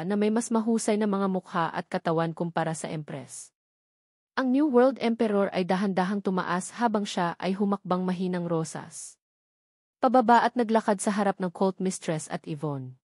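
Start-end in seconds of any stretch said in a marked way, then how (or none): none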